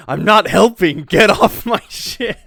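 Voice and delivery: acted-out indignant shout; mock angry outburst. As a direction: voice an exasperated character yelling them off. Retold outburst of annoyed Law.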